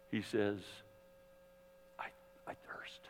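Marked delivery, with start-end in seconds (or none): 2.0-3.0 barely audible, breathless whisper of a dying man; faint whisper. A narrator's reading. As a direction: whisper it so only those nearest can hear. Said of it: "in a voice that probably only the people at the foot of the cross ... could hear".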